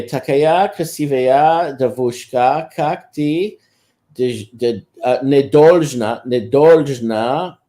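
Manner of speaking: slow Russian, drawn out word by word; careful recited Russian. Shown as a demonstration. Man repeats his Russian come-on line for a friend, slow and careful. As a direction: recite it carefully in learner's Russian, stretching the words. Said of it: "I'll say it again".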